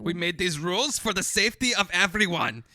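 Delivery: loud, high strict enforcer voice; bossy inner rule follower. Pretend. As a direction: scold like a rigid rule enforcer. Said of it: "The Hitler inside".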